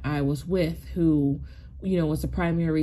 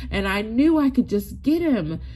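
ordinary talk, nothing marked

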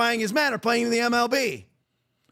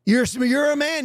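ordinary delivery

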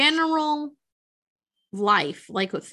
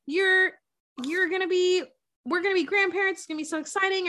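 mock overjoyed parents, pitched up without shouting; retold parental excitement; 7 semitones higher, faster than usual. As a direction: imitate thrilled soon-to-be grandparents. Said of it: "lose their mind. They're like".

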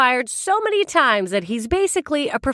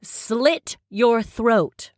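slow menacing quote; much slower than usual. Retold or imitated. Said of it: "and I quote".

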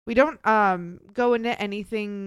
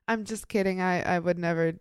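ordinary talk

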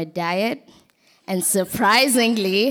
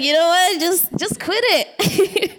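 fed-up inner voice; 8 semitones higher than usual. Retold or imitated. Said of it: "I was like".

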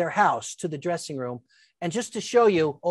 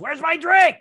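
raised, higher mock yell of an impatient boss; retold yell; 9 semitones higher, 7 dB louder than usual. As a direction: call it out loud and demanding. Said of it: "You know, she's yelling".

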